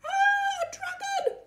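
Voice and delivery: high frightened cry; startled shriek. Acted. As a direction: squeal it in alarm. Reader shrieks at dragon.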